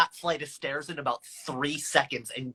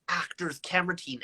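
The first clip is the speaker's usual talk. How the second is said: normal talking voice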